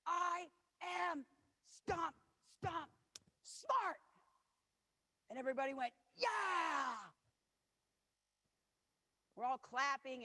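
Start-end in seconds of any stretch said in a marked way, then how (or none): 0.1-4.0 put-on high, bold child's chant; proud little girl. Ten-year-old announcing to the circle, proud child chant. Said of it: "little Lily does this". 6.2-6.9 high cheering shout; group cheer. Retold or imitated. The women cheer for Lily, shouted cheer.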